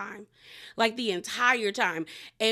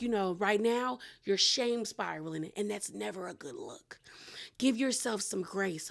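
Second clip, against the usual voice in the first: soft, gentle consoling voice; reassuring imitation; 6 dB quieter than usual. Host mimics the show's boss comforting a castmate, softly reassuring. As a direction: play the kindly counsellor, hushed.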